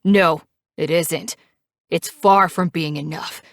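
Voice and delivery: loud defiant retort; screaming. A narrator's reading. Knives shouting down Reaper's warning, loud. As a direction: push back hard at Reaper. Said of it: "He continues to scream".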